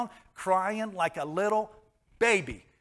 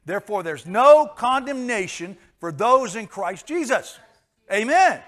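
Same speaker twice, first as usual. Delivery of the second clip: loud congregational declaration; 6 semitones higher, 12 dB louder than usual. Real, not acted. Preacher leading the church in unison, loud proclamation. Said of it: "Say it with me".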